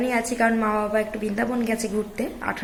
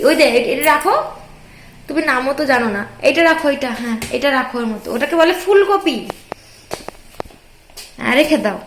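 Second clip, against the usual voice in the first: mother cooing at her little daughter, higher; 4 semitones higher, 6 dB louder than usual. Real, not acted. Mother urges toddler to tidy up.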